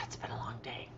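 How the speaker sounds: "God, it's been a long day" breathed out as a whisper; tired whisper. Real, not acted. To her stream viewers, worn out, in a whisper. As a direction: sigh the words out as a whisper.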